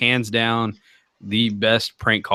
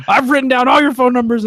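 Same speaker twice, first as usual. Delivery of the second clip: mock threat; 11 semitones higher, 5 dB louder, faster than usual. Victim threatens the callers.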